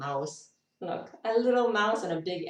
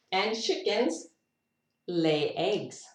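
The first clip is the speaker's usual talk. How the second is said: ordinary talk, nothing marked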